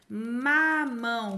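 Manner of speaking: "Mamão" drawn out slowly; teacher stretching "Mamão"; slow syllable demo. Shown as a demonstration. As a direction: sound out "mamão" for small children.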